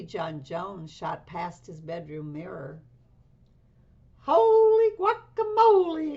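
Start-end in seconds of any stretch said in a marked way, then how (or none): 4.2-6.1 much higher, louder shocked cry; startled exclamation. Acted. Kitten sees himself in the mirror mid-bounce, startled shout. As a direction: yelp it at the sight in the mirror. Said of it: "exclaimed Skippy John Jones".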